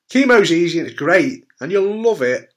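loud, bright salesy mock praise; mock cheerful lie. Patient mocking false reassurance, loud mock cheer. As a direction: oversell it like a fake advert. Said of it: "If I said".

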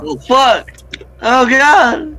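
high, loud cries of alarm; panicked yelling. Real, not acted. Player caught by an enemy in game, panicked high yelling.

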